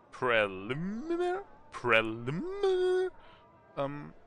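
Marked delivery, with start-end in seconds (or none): 0.1-3.1 high rising puzzled Preliminary; mock-puzzled sliding up on Preliminary; questioning rise. Shown as a demonstration.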